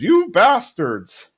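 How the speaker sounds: high-pitched, drawn-out outraged cry; slow, high mock outburst; mock yell. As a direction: imitate an outraged yell, not full volume. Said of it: "Hank just yells".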